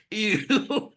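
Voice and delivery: high, loud mock "ew"; disgusted announcer. Recalling the presenter's reaction to the winner, mock disgusted. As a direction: imitate her blurted disgust, pitched right up. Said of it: "didn't think she'd go".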